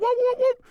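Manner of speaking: high, loud goofy whoops; silly whoop noise. Shown as a demonstration. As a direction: whoop like a cartoon siren, nose pinched. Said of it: "go whoop".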